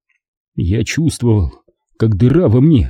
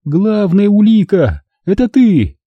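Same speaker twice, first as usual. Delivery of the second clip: higher, stern mother's voice; stern accusation; 9 semitones higher than usual. Acted. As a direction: pin the blame on him. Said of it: "строго посмотрев на меня". (строгая мать обвиняет сына)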